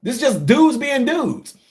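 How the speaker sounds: high, baffled protest; a hint of mock disbelief to the wife; mock incredulous reply. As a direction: act amazed she thinks it's a fight. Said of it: "we look at her like".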